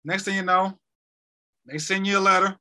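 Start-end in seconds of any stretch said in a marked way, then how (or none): none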